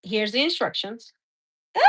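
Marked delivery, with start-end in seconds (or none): 1.8-1.8 sharp short yelp of surprise; startled cry. Real, not acted. Dirt pours out of the bag, a startled yelp. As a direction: yelp as the mess spills.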